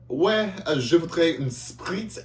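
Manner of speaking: put-on French accent, high and slow; nervous student ordering in a French accent. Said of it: "I was in the accent".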